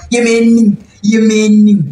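northern accent. Shown as a demonstration. Streamer showing off her accent, northern tonic stress. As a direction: show off a northern tonic accent on each repeat. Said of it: "l'accent tonique du septentrion".

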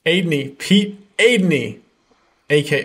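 loud, drawn-out show-host welcome; announcer introduction. Real, not acted. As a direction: announce the guest with big fanfare.

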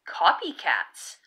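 ordinary delivery, nothing marked

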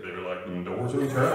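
deep country-singer impression. Country singer giving a line, low put-on voice. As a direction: drop way down for the opening.

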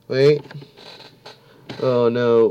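ordinary delivery

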